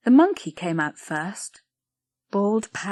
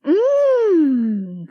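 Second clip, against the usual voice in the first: high, loud savouring mm; a greedy savoring hum; delighted hum; 8 semitones higher, 8 dB louder than usual. Acted. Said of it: "cried Blodwin".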